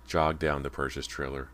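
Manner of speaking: plain reading voice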